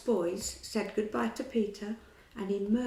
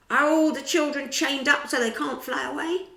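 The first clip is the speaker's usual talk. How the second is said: captain's demand; 6 semitones higher, 8 dB louder than usual. A narrator's reading. Hook questions crew.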